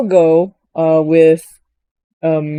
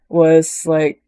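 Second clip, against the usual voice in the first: ordinary talk, nothing marked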